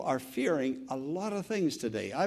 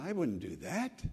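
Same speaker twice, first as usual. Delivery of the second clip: normal talking voice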